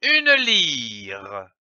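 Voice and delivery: "une lyre" as one long, falling word; whole word joined. Shown as a demonstration. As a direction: blend "lyre" together and stretch it out. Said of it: "L'association de ces syllabes donne".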